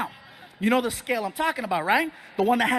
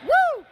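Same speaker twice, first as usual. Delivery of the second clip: high-pitched, louder "woo"; high whoop; 5 dB louder than usual. Retold or imitated. Comic reacting to the man's free fall, high whoop. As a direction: let out one high, loud whoop. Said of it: "And I was like".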